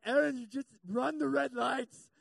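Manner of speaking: begging in a swelling panic; desperate plea. Retold or imitated. A man struggling to breathe begging his wife to run red lights.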